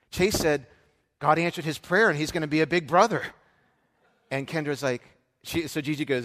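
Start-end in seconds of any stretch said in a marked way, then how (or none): 1.2-3.2 imitating a thrilled teacher, louder and higher; excited announcement. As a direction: proudly announce big news, raised voice. Teacher relays the big news.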